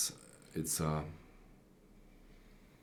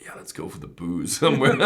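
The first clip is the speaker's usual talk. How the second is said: jolly soldier voice; 7 dB louder, faster than usual. Retold or imitated. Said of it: "the Doctor Soldiers were always like".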